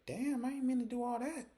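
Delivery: very high-pitched, taken-aback protest; mock backing down. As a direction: squeal it lightly as you back off. Zach backs down from threat.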